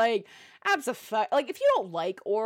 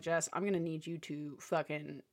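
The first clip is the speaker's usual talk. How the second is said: low, much softer, stern put-down; stern scolding; 7 semitones lower, 5 dB quieter than usual. Retold or imitated. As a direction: quietly tell her to sit down. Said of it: "I was like".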